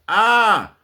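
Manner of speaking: sharp, loud, high "ah" of triumph; triumphant shout. Real, not acted. Capping his argument for viewers, triumphant cry. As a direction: bark out a single victorious cry.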